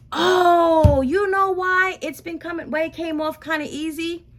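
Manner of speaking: high, loud burst of realization; sudden realization. Real, not acted. Vlogger realizing why hair came off easy, surprised. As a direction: it just clicked, tell them.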